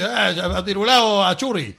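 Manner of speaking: slow, loud fake Basque phrase; mock Basque speaker. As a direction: pretend to answer in Basque. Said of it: "me dicen". (vasco fingido, lento y alto)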